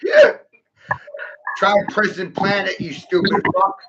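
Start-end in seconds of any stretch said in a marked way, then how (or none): 0.0-0.3 a shrill burst of triumph; sudden squealing yell. Acted. Ranting at listeners, bursts into a high yell. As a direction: yelp it out very high and loud. Sudden outburst mid-rant.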